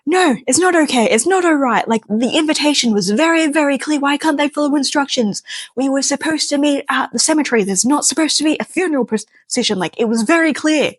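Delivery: high, frantic retold rant; ranting in panic about the instructions; freaking out. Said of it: "full on freaking out".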